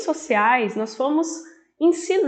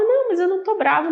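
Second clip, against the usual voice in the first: higher, quick retold denial; retold protest; 8 semitones higher, faster than usual. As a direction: protest quickly in a raised pitch. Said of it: "Eu falava".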